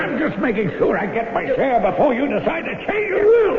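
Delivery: high, strained sneer through the effort; menacing while strangling. Acted. Murderous son to father he strangles, strained menace. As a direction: squeeze out each word as you choke him.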